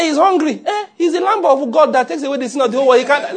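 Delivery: high, laughing mock Mary brushing off hunger. Mary dismissing Martha with a laugh, high and amused. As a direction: laugh her way past the excuse. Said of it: "He said".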